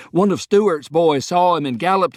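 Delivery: high and loud, eager to tell; bursting with the news; excited report. Acted. Taylor explaining to Lee how the president was spotted, excited and high. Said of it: "Taylor nodded excitedly".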